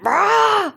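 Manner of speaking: high, loud mock dinosaur roar; T-Rex roar. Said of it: "A T-Rex".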